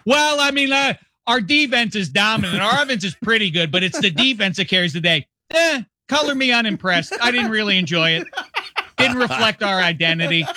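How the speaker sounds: high, smug mock fan voice; hammed-up unimpressed fan; mock Arizona fan. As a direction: play the snooty fan who shrugs off a defense win. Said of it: "would that be the".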